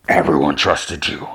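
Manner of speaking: low, monstrous accusing voice; distorted accusation. Acted. Gunman accusing the woman he trusted, low distorted voice.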